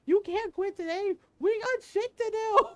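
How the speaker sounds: high, loud mock-scolding of herself; yelling at myself. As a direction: push yourself through tears. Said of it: "telling myself".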